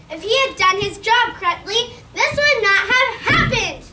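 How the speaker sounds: loud, furious royal voice; angry king. Acted. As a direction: rage at the inventor. The king rages at the inventor.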